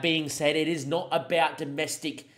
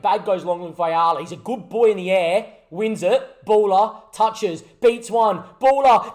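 commentary building louder and higher; excited play-by-play; 4 semitones higher, 8 dB louder than usual. Acted. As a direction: follow the attack with rising excitement. Live commentary on own match.

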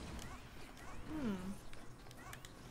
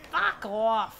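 a hint of Boston accent; Boston accent fuck off; 4 semitones lower, 4 dB louder than usual. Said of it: "Boston accent".

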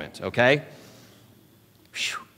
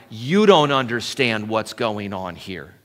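usual speaking voice

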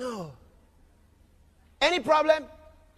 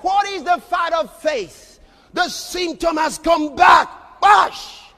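slow, loud, higher preaching; full-force preaching; 3 semitones higher, 8 dB louder, slower than usual. Acted. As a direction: thunder it from the pulpit.

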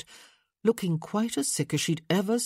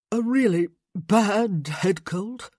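sick man voice; 4 semitones higher, 6 dB louder, slower than usual. Acted. Sick man joking slowly.

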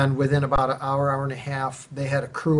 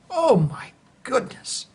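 much higher, slow astonished gasp; slow, high awestruck exclamation; 10 semitones higher, slower than usual. Retold or imitated. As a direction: stretch it out in awe. Seeing fallen tree.

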